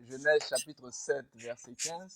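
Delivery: distant, faint off-mic voice; too far from the microphone. Real, not acted. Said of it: "On entend de loin".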